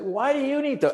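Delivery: retold indignant question, pitched high; pissed-off challenge. Stranded traveler answering an airport cop, pissed-off challenge. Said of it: "now I'm getting pissed off".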